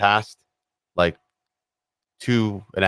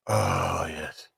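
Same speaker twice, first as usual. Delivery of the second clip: softer, lower sigh of pleasure; savouring; 3 semitones lower, 9 dB quieter than usual. Real, not acted. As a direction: let the first sip melt you. First sip of beer.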